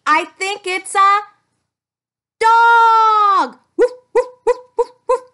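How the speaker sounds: loud, high happy shout and barks; big playful woofs; excited reveal. Real, not acted. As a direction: cheer the dog, then bark.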